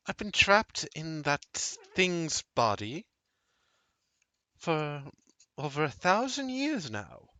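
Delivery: deliberate, lilting woman's voice; mysterious ancient woman. Acted.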